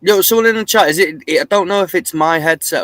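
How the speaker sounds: loud, high call to the chat over a bad connection. Real, not acted. Streamer calling loudly to his chat about the mic. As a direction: hail the chat loudly, checking the audio.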